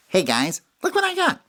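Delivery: high squeaky little critter voice; Smalls' voice. Acted. Storyteller voicing Smalls showing off his find. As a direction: pitch way up as the small animal.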